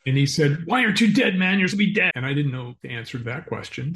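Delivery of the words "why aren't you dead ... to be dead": high, loud panicked disbelief; freaked out. Retold or imitated. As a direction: retell the gunman's frantic outburst, pitched up. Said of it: "he freaked out".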